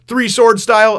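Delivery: loud, high imitation of a shouted insult; mock yell. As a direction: holler it like a silly comeback. Luffy's silly insult, acted out.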